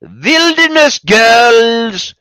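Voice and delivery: high, loud, drawn-out singsong call; hammed-up doorstep announcement; film character's call. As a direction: stretch both words out, pitched high. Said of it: "to the door and goes".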